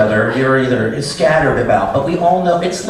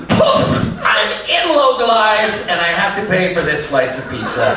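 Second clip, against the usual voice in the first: startled realization; 6 semitones higher than usual. Retold or imitated. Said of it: "I was just like".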